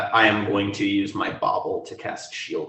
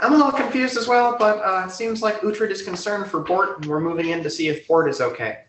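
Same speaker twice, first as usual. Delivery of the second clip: character voice; 10 semitones higher than usual. Acted. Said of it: "I'm like".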